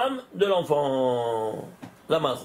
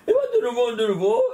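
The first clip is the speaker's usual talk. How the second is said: triumphant; 4 dB louder, faster than usual. Real, not acted. The same number appears again.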